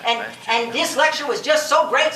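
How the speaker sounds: ordinary talk, nothing marked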